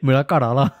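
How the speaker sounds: Karala said with a final a, not Karali; mock the slip, Mera Karala; mispronounced Karala. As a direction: friend teased for saying Karala. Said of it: "You were like".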